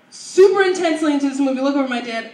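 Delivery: normal talking voice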